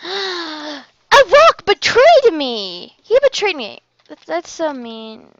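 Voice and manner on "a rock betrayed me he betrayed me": gasp, then slow, loud, higher mock outrage; dramatic gasp of a wronged hero; mock betrayal. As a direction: ham up being betrayed. Mock outrage over game death.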